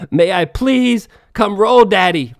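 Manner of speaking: loud, slow, drawn-out mock plea; mock begging. Begging the rival coach to let him roll, hammed-up loud plea. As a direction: beg him loudly to let you roll. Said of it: "Begging this bitch".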